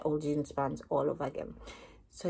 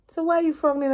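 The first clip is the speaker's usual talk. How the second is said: higher, louder puzzled question; puzzled doctor; 10 semitones higher, 6 dB louder, faster than usual. Retold or imitated. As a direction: sound baffled by her frown. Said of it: "So then he said".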